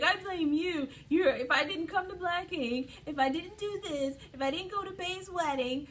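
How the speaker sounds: high, whiny mock tantrum; Alex. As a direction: imitate him mad and puffy, piling up the blame. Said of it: "he gets all mad and puffy".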